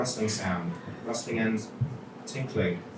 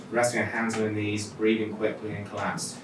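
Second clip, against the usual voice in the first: plain reading voice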